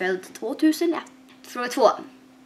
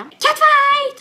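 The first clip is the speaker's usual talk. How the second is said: much higher and louder, punchy; dramatic catfight; 6 dB louder than usual. Acted. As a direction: yell it like a playground drama. Dolls quarrel again.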